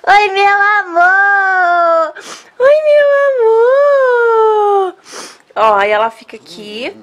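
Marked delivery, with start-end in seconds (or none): 0.1-2.1 pet talk. Real, not acted. Greeting her beloved pitbull, high slow cooing baby talk. 2.6-4.9 much higher, slow, voice breaking into tears; tearful pet talk. Real, not acted. Saying goodbye to the dog she must give away, tearful high coo. Greeting dog, about to cry.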